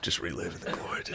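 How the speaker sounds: breathy mock whisper, muffled. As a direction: whisper it like a sad old regular. Mock whisper of bar regular.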